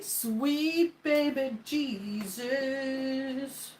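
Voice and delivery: slow, high, stretched-out exclamation; drawn-out exclamation. Acted. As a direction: draw it out like a hammy praise. Exclaiming over onions.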